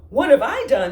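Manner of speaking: high, loud cross shout. Acted. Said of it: "shouted Armadillo crossly".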